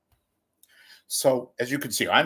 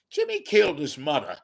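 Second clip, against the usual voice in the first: normal talking voice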